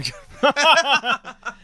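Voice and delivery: high loud burst of laughter. Real, not acted. Laughing at Jack.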